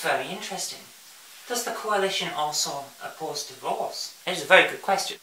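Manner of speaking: hushed, dry, sarcastic murmur; quiet sarcastic aside. Acted. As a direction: drop to a sly undertone.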